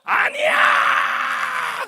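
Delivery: long, drawn-out, high theatrical shout; overdone shout. Retold or imitated. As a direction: ham it up on stage, stretching the word. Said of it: "조금 오버하면서". (과장되게 길게 끌며 높이 외치는 소리)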